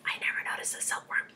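quick, hushed whisper; soft, breathed comment outside the story; whispered aside. Real, not acted. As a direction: whisper it confidingly to the listeners.

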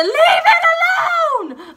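high, drawn-out hysterical scream; over-the-top wailing shriek. Retold or imitated. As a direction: scream a tearful plea at the top of your range. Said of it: "Like [name] in the Leave [name] Alone video".

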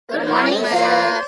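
loud chanted good morning to sir; student greeting teacher loudly in chorus; loud class greeting. Acted.

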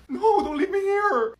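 high, drawn-out whine; panicked pleading. Acted. Shy guest begging friend to stay, panicked whine. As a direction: beg urgently without raising the volume.

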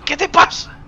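high, loud quoted question; imitated alarmed grandmother. Player imagining grandmother coming upstairs, imitated alarm. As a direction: call out like a worried grandmother. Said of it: "Va a subir mi abuela".

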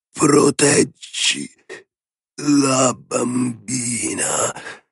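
very slow, hoarse rasp fading away; a dying man's last plea, remembered. A narrator's reading. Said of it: "la voce rauca". (voce rauca e lentissima di moribondo)

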